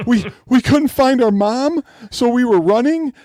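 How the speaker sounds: put-on upset kid. Kid telling stranger about yelling guard, high tearful imitation. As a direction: voice the scared little kid nearly in tears. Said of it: "almost got tears in his eyes".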